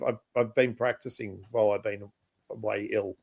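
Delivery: ordinary talk, nothing marked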